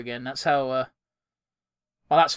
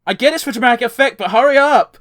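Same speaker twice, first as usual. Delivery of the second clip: impatient shout; 6 semitones higher, 7 dB louder than usual. Real, not acted. Impatient at slow scene.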